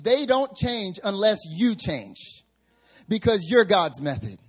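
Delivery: loud, slow preaching, every change hammered home; full-force preaching. Acted. Preacher driving home his point to the congregation, loud and slow.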